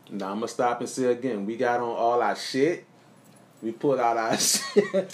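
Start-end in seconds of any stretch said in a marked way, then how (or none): 3.6-5.1 laughing. Real, not acted. Laughing mid-line.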